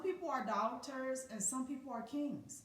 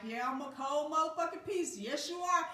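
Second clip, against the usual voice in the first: boastful self-hype; 5 semitones higher, 6 dB louder than usual. Retold or imitated.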